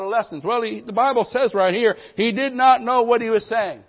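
loud, high, punchy sermon delivery; full-force preaching. Acted. Preacher rallying the church, loud and high. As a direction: drive each line home to the crowd. Preacher hyping the congregation on Peter.

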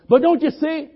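high, loud anxious protest; frightened young servant pleading; panicked servant. Acted. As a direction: blurt it out in panic.

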